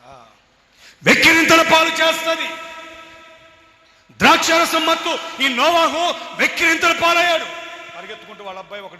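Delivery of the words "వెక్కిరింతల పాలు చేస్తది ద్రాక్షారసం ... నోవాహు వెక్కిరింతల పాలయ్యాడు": high, loud fiery preaching; preaching at full force. Real, not acted. Preacher warning the congregation, fiery full-force preaching. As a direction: hammer the warning home.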